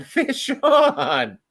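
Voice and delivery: high shout breaking into laughter; laughing shout. Retold or imitated. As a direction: laugh while you yell it. Calling out a hooked fish.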